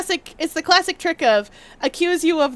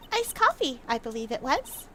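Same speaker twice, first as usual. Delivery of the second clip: witness voice; 5 semitones lower, 9 dB quieter than usual. Acted. Witness answering the lawyer, lightly voiced. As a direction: say it coyly as the witness.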